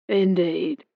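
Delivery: guarded one-word reply that closes the topic; retired captain brushing off the question; non-committal. Acted. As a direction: give nothing away, keep it short. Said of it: "in a non-committal voice".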